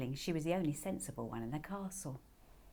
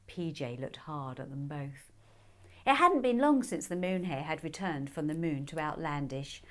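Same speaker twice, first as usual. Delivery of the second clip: usual speaking voice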